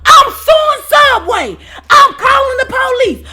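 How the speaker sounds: high, loud, hammed-up screech; mock screaming woman. The lady on the floor threatening to call police, shrieking. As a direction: imitate her screaming for the police. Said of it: "screaming to the top of her lungs".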